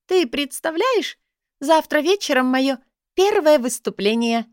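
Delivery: high, bright, joyful cry; jubilant shout. A narrator's reading. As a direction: shout it out in sheer delight. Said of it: "буквально прокричала последние слова в порыве ликования".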